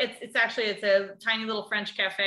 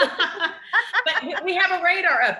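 high and loud, slow through a laugh; 10 semitones higher, 7 dB louder, slower than usual. Real, not acted. To her friend about Brits, laughing high and slow.

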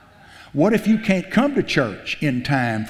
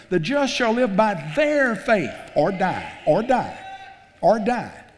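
preaching at full force; 7 semitones higher than usual. Real, not acted. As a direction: hammer the scripture home. Quotes scripture, repeating "or die.

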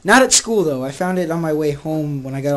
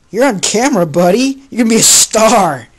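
loud, higher-pitched excited call; yelling at dog; 5 semitones higher, 10 dB louder than usual. Real, not acted. Vlogger tells dog he's on camera, loud yelling. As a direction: holler it at your pet like a hype man. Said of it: "he thinks I'm yelling at him".

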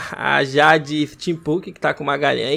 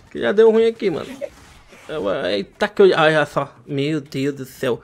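usual speaking voice

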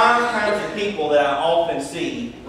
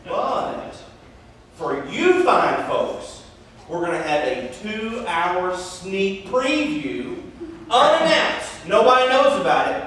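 dramatic announcement. Retold or imitated. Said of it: "chipper sixteen-year-old guy says".

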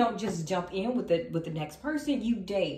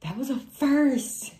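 high, loud, drawn-out amazement; slow, raised-voice astonishment; astonished; 5 semitones higher, 6 dB louder, slower than usual. Real, not acted. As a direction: stretch it out in surprise. Surprised by what just happened.

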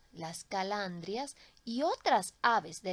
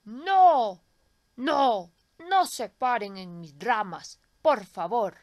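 put-on old tree pleading; 10 dB louder, slower than usual. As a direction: cry out to shoo the birds off. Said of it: "dijo el árbol a los pajaritos".

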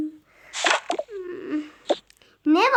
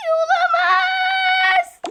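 dramatic wail; 3 dB louder, much slower than usual. Acted. Dramatic cry over hair.

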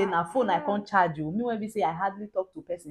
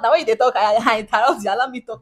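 raised voice, insisting on the floor; loud protest; 4 semitones higher, 14 dB louder than usual. Real, not acted. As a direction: cut in loudly to reclaim your turn. Protests at being interrupted.

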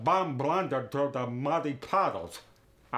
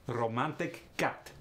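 "romantic cat" with ah vowels, Russian accent; Russian accent, "cat" said like "kaht"; Russian ah sound. Shown as a demonstration. [name] shows the first variation, Russian ah sound. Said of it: "You got the ah sound that time".

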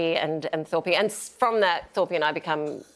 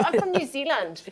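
higher, exaggerated New Zealand accent; strongest Kiwi accent; 6 semitones higher than usual. Pleading to the cop who pulled her over, thick Kiwi accent. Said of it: "I said it in the strongest Kiwi accent".

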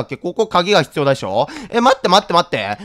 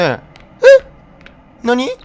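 ordinary talk, nothing marked

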